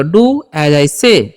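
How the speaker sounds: drawn out word by word for learners; very slow classroom demo; very slow model sentence. Shown as a demonstration. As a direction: spell it out slowly like a teacher. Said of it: "teacher models an English sentence".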